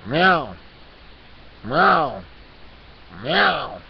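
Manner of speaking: mock-angry cat meowing at the listener. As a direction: meow insistently, one at a time. Speaker meows as the cat.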